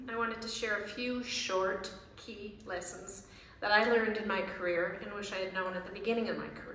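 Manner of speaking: normal talking voice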